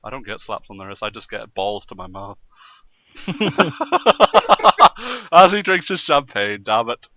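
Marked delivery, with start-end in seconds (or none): 3.2-5.3 shrieking burst of laughter; loud high cackle. Real, not acted. Laughing hard at his own joke to co-hosts, loud cackle.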